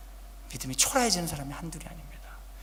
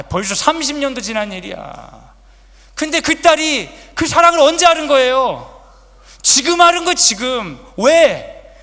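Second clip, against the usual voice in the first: preaching at full force; 9 semitones higher, 15 dB louder than usual. Acted. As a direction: shout the climax to the congregation. Climax of sermon.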